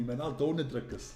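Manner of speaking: ordinary delivery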